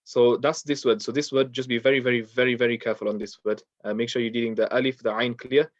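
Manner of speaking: normal talking voice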